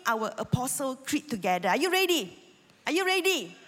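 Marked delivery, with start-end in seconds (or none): 1.7-2.3 raised, eager question to the crowd; rallying call. Real, not acted. Pastor rousing the church before reading, loud and eager. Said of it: "come on, let's read aloud". 2.9-3.5 higher still, urging the crowd; rallying call, pushed harder. Real, not acted. Pastor asking the church again, higher and louder. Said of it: "come on, let's read aloud".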